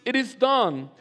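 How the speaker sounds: slow, loud, high scripture proclamation; preaching at full force. Acted. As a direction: proclaim each line of Revelation with building fervour.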